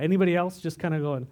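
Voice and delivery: normal talking voice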